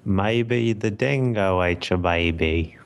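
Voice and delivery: slow, broad attempt at an Australian accent. Shown as a demonstration. Player showing the host his best Australian accent. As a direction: stretch the vowels in your best Australian accent. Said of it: "your best Australian accent".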